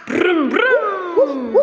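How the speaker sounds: car noise. Pretend. Imitating a car on the way.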